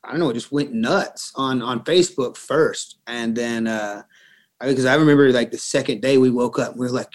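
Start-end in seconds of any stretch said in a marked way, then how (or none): none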